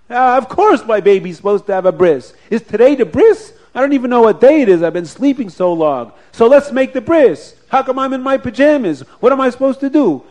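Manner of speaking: eager revived father. Acted.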